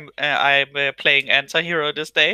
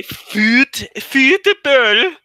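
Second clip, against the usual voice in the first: put-on Swedish accent; 7 semitones higher, 5 dB louder, much slower than usual. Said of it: "that's too Swedish".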